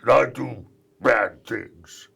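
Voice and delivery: slow, loud menacing robot; slow, loud villain robot introducing himself; Gutsman. Acted. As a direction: boom it like a proud dumb villain. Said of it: "I had a voice".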